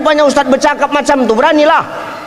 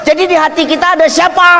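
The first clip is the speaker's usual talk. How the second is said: furious wife; 5 semitones higher than usual. Retold or imitated. Wife yells at husband about who is in her heart, mock-furious shout. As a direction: yell it like an outraged wife. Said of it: "Dia ngamuk".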